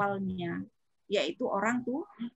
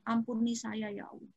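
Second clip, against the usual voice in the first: mock prayer of regret; 7 dB quieter than usual. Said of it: "Nyesal kita".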